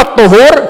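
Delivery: "الطهور" with a u after the ط; ضم الطاء. Shown as a demonstration. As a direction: say "الطهور" as aṭ-ṭuhūr. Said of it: "فهو اسم للفعل". (نطق "الطهور" بضم الطاء)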